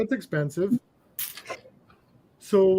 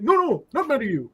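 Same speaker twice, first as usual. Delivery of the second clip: retold upset clerk reassuring; 7 semitones higher than usual. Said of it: "he starts getting mad at me".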